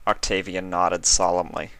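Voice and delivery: ordinary delivery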